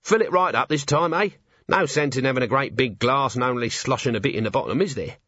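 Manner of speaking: chummy tippler ordering a refill; cheery, cheeky working man at the bar; Nobby. Acted. Man grumbles to his host about a stingy pour, chummy.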